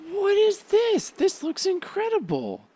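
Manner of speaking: high giddy fan squeal; squealing delight. Real, not acted. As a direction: shriek with giddy joy. Thrilled by episode list.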